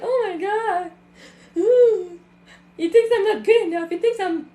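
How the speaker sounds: high, loud hammed-up sobbing with a laugh; mock crying. Coach mocking a hurt follower, hammed-up sobbing. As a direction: wail it like a wounded victim, half-joking.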